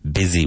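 "Busy" rhymes with dizzy, not fussy; Busy said as bizzy. Shown as a demonstration. Teacher to students: "Busy" said as bizzy. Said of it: "even though it's spelled B U S Y".

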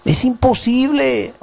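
lightly mocked stubborn member; mock stubborn believer. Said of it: "Y dice".

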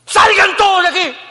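very high, loud shouted command; furious shout. Retold or imitated. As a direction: roar everyone out. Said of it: "cómo habrá estado José cuando dijo eso".

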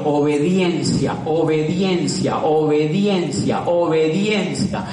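obediencia drawn out slowly each time; slow emphatic chant; slow hammering repetition. Acted. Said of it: "chanted repetition".

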